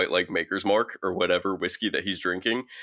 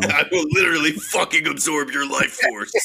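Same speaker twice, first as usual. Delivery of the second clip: higher, hammed-up threat; comic raised-voice menace; mock menacing mother; 6 semitones higher than usual. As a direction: threaten like an over-the-top mom villain. Acts out mother's threat, escalating the joke.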